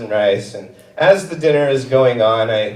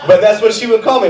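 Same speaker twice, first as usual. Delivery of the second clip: ordinary delivery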